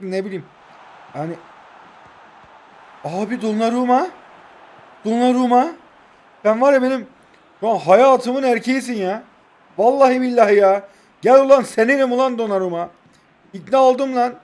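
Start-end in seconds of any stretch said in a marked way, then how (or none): 3.0-14.3 loud, high gushing over the goalkeeper; excited yelling. Real, not acted. Streamer raving at his goalkeeper in an excited high yell. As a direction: shout his love at the keeper.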